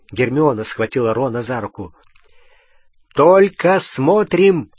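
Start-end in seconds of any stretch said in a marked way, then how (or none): 3.1-4.7 slow, loud firm order; firm command. A narrator's reading. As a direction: lay down the rule slowly. Harry ordering in danger. (твёрдый громкий приказ)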